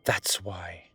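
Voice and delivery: short confiding whisper. Acted. Telling the student why she can't, whispered. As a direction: breathe it out like a secret.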